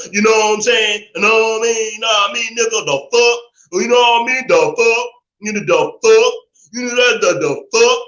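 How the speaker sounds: hammed-up street talk, punchy and sing-song; mock slang talker. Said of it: "'Cause she doesn't talk like a".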